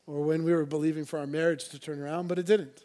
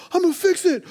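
much higher and louder, hammed-up fix-it husband; mock husband; 10 dB louder than usual. Pastor mimicking a husband whose wife cries, loud mock eagerness. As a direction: play the husband who must fix everything. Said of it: "He's like".

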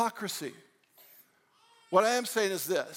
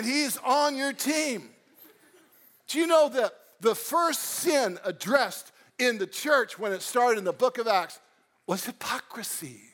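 ordinary delivery

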